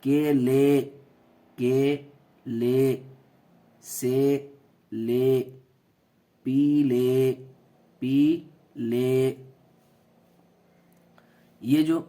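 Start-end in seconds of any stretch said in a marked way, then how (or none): 0.0-9.3 کیلے سیلے پیلے with the final ے stretched long; drawn-out ے sound. Shown as a demonstration. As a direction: stretch the ending slowly. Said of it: "یہ بڑی یا کی آواز".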